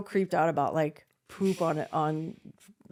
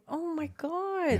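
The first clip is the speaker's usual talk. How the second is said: appalled; 10 semitones higher, much slower than usual. Retold or imitated. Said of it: "And I'm going".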